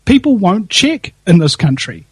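ordinary talk, nothing marked

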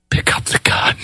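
menacing gunfighter whisper; Jack Palance whisper. Retold or imitated. Gunfighter taunts the sheep herder to draw, in a menacing whisper. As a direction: whisper the dare, cold and threatening. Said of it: "You're like Jack Palance in the movie Shane".

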